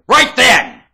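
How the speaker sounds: loud, high outburst; abrupt high yell; sudden shout. Real, not acted. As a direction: burst out at full volume. Sudden bark amid ranting.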